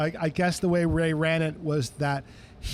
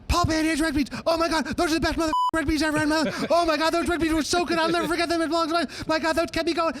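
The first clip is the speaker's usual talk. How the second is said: ecstatic [name]; 11 semitones higher, faster than usual. Retold or imitated. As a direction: rave about the beans like Ray. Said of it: "[name] is blowing my microphones".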